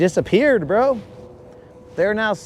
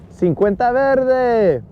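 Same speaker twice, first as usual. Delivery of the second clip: mock Spanish; 6 semitones higher, slower than usual.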